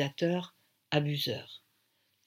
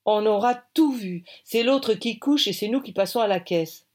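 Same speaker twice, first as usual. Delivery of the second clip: loud, indignant outburst; higher, louder grumbling exclamation; exclaiming; 5 semitones higher, 6 dB louder than usual. A narrator's reading. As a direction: cry out in raised-pitch protest. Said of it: "s'écrie".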